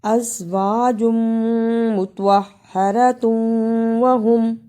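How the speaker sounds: अज़वाजुम मुतहहरतुम with long hummed m joins; idgham demonstration. Shown as a demonstration. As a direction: hold the m between अज़वाजुम and मुतहहरतुम. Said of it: "इदगाम आया". (अज़वाजुम मुतहहरतुम, मीम पर गुन्ना खींचकर)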